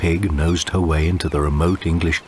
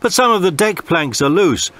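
put-on higher, louder cabin boy reply; shouted; 10 semitones higher, 4 dB louder than usual. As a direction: call up cheerfully to the captain. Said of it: "shouted Tom".

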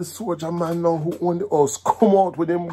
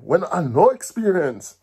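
plain reading voice

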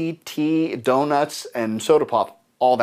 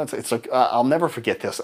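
normal talking voice

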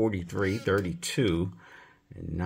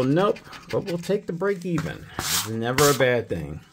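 ordinary delivery

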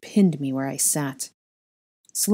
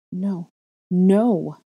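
second "no" louder, forced out; ground out; 3 semitones higher, 4 dB louder than usual. A narrator's reading. Horrified girl seeing the dark mark, ground-out denial. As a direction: grind out the denial, building in force. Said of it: "I ground out".

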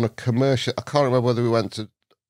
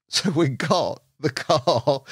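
ordinary talk

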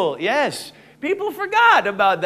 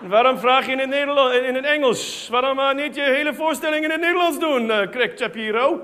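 fast, higher indignant Dutch; Dutch people speaking Dutch; 4 semitones higher than usual. Retold or imitated. Comic mimics Dutch audience members, fast and indignant in Dutch. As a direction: rattle it off like an annoyed Dutch local. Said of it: "These are the people that say".

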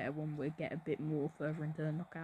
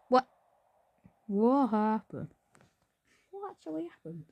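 confused; 3 semitones higher, 5 dB louder than usual. Real, not acted. Confused by a game event.

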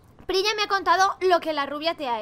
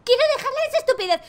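a touch of mocking, higher and fast; mocking quote; 9 semitones higher, 5 dB louder, faster than usual. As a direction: parrot her words back, higher and fast. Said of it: "me cago en la leche". (repitiendo con burla, más agudo y rápido)

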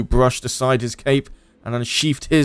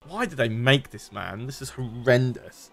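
ordinary talk

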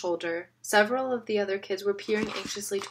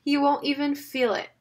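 scoffing puff, then higher and louder taunt; mock him openly; jeering; 6 semitones higher, 6 dB louder than usual. A narrator's reading. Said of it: "he pointed at J B jeeringly".

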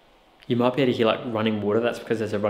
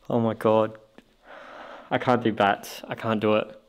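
ordinary talk, nothing marked

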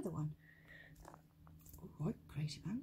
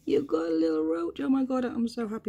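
higher and louder, thrilled; excited delight; 7 semitones higher, 6 dB louder than usual. Real, not acted. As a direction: burst out with joy. Spider finally eats.

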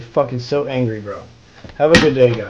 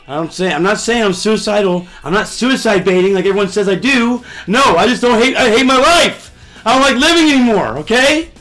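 angry rant; 7 semitones higher, 6 dB louder than usual. Real, not acted.

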